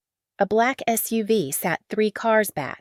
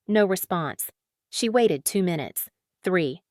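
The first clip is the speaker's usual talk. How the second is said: ordinary delivery, nothing marked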